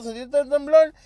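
"¿Sentiste el temblor?" mucho más agudo; pregunta ansiosa. Retold or imitated. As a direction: preguntando con urgencia, agudo. (amigos preguntan por el temblor, voz aguda)

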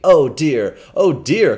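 alarmed cry of pity. Acted. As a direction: cry out in dismay. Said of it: "cried the benevolent stranger".

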